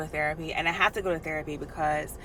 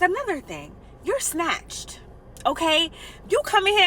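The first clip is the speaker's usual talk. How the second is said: excited therapist; 10 semitones higher than usual. Retold or imitated. Voicing therapist's hype compliments.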